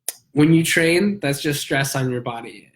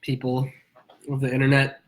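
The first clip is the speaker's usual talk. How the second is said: stuffy, nasal voice from a cold; nasal sick voice. Real, not acted. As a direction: talk through a blocked nose. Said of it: "as you can tell from my nasally voice".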